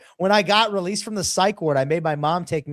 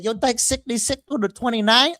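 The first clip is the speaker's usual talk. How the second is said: put-on Vietnamese accent; 7 semitones higher than usual. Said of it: "Is this the wrong show for my accents?".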